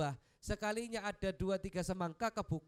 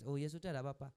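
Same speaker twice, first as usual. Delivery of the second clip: patient calm reply; 6 semitones lower, faster than usual. Retold or imitated. Prophet gently accepting that there is no food, calm. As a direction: accept it kindly, no anger. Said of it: "Nabi sabar, nggak marah".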